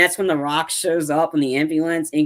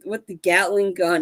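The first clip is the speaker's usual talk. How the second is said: ordinary talk, nothing marked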